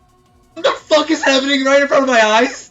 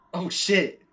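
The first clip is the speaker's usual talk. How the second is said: surprised; 7 semitones higher, 9 dB louder than usual. Real, not acted. Reacts to the duel.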